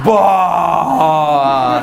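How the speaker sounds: loud exclaimed bah for a great goal; excited bah. Shown as a demonstration. Demonstrates fans' bah after a golaço, loud and thrilled. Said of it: "o que que tu faz?".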